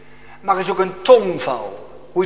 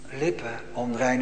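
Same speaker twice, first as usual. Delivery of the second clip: ordinary talk, nothing marked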